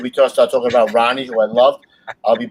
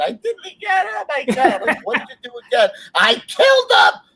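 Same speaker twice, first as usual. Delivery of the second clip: high-pitched mock sobbing, laughing through it; hammed-up high wailing confession; crying lady; 14 semitones higher than usual. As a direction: play the hysterical woman, half laughing. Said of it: "Oh, she's crying".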